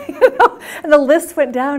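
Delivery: laughing it out, high and loud. Real, not acted. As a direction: burst into real laughter mid-story. Laughs recalling client's list.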